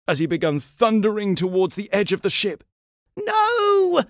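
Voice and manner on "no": very high, alarmed yell; shouted. A narrator's reading. First mate stops George at the ship's edge, shouting. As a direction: cry out to stop him. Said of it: "shouted First Mate Steve".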